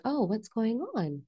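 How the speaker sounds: high, wondering self-talk; curious observer. Acted. Coach showing listeners how to observe, high-pitched curiosity. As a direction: lilt up high with open curiosity. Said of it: "Just become the observer of like".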